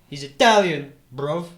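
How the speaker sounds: loud mock British reveal; British accent. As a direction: blurt it in hammed-up British. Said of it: "now I just do it like how they actually say it".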